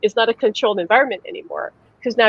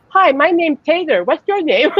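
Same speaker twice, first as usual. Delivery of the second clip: higher, eager introduction; friendly little boy; 7 semitones higher, 4 dB louder than usual. Retold or imitated. Son introducing himself to kids in the pool, higher and eager. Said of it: "He'd go".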